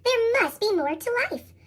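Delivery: ordinary delivery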